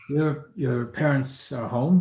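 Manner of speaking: measured, unhurried butler; old servant speaking to the family's daughter; slow servant voice. Acted. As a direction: announce it slowly and formally.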